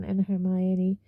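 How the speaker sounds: plain reading voice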